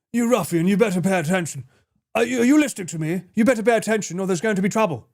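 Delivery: posh accent. Shown as a demonstration. Posh speaker failing to scare someone, posh accent. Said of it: "Whereas if I said".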